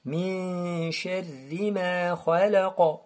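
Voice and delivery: slow "Min sharri ma khalaqa", long hummed "Min"; qalqala recitation. Shown as a demonstration. Teacher recites whole verse, bounced end on "khalaqa". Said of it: "vibration ici".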